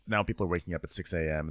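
ordinary talk, nothing marked

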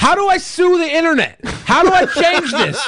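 high, outraged shout at the internet; angry shouting. Real, not acted. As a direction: yell it, furious and disbelieving. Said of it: "I am so mad right now".

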